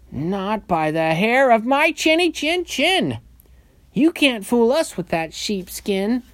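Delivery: high piggy voice, loud in bursts; defiant little pig. A narrator's reading. Second pig defies the wolf.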